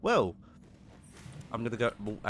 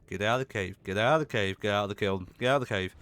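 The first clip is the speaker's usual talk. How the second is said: loud, insistent repeated order; shouted command; 6 dB louder, much faster than usual. Real, not acted. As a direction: shout it over and over to drive something away. Yelling at creature to leave.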